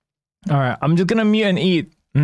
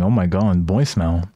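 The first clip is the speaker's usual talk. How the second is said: deep mock voice; 9 semitones lower than usual. Mocking the boy smell clip.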